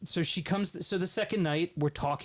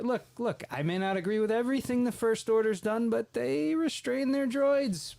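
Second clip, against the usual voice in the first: mock pompous voice; 6 semitones higher than usual. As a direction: defend it with mock conviction. Jokingly voicing an Imperial view.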